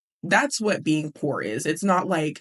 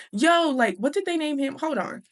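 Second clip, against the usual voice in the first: raised, high-pitched acted call; 9 semitones higher, 3 dB louder than usual. Older man hails a boy in line, calling out. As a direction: hail someone in a lifted voice.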